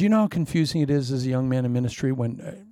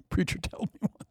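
laughing; 5 semitones higher, 7 dB quieter than usual. Acted. Congregation mock call-out.

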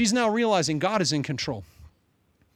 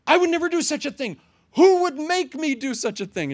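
outraged king; 10 semitones higher, 4 dB louder than usual. Acted.

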